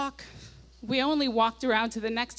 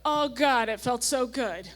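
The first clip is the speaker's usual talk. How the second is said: all caps; 2 semitones higher than usual. Retold or imitated. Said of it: "All caps".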